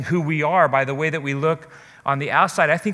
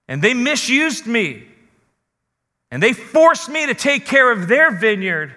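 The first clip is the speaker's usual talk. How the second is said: anguished outcry; 6 semitones higher, 4 dB louder than usual. Acted. Preacher voicing abused woman's cry.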